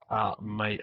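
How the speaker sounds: ordinary talk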